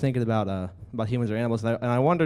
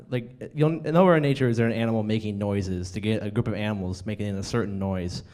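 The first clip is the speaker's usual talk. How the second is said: ordinary talk, nothing marked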